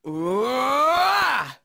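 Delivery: rising, drawn-out battle yell; battle cry. Acted. Actor recording a fighter's battle cry, rising yell. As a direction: let out a long yell that climbs high.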